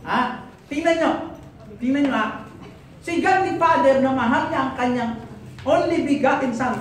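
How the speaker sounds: ordinary talk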